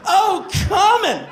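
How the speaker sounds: high, loud, drawn-out cry; loud orgasmic exclamation. Retold or imitated. Man near climax cries out to a jar, exclaiming loudly. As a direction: exclaim it at the height of pleasure. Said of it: "I exclaimed".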